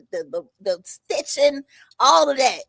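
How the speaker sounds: ordinary delivery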